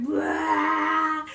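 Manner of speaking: loud mock vomiting blurt; retching noise. Storyteller acts out throwing up at her friend, mock retching. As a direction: imitate a big heave of puke.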